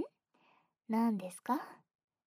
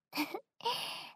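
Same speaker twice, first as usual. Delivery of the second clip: high, rising little giggle; light playful titter; teasing giggle; 10 semitones higher, 4 dB quieter than usual. Acted.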